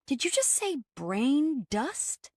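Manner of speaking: slow, disbelieving high question; can't believe what she heard; incredulous. A narrator's reading.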